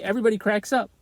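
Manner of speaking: ordinary talk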